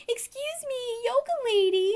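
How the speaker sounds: high-pitched tipsy busybody; drunk bartender. Retold or imitated. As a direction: imitate a drunk woman calling out, pitched high. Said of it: "she's, like, drunk somehow".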